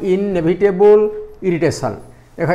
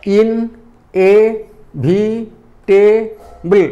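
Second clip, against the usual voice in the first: "ଇନ୍ଏଭିଟେବୁଲ୍" in five slow, separate beats; "ଇନ୍ଏଭିଟେବୁଲ୍" stretched out as ଇନ୍-ଏ-ଭି-ଟେ-ବୁଲ୍; syllable by syllable; 2 semitones higher, 3 dB louder, much slower than usual. Shown as a demonstration. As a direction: pause after each syllable of "ଇନ୍ଏଭିଟେବୁଲ୍". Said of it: "ଗୋଟେ କ'ଣ pause ନେଇକି".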